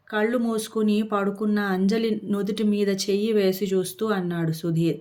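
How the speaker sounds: usual speaking voice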